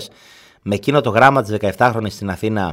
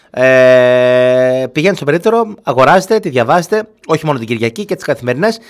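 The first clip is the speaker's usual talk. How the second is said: upbeat ad read, higher; newspaper plug; 3 semitones higher, 4 dB louder than usual. Acted. Host urges listeners to buy the Sunday paper, upbeat ad read.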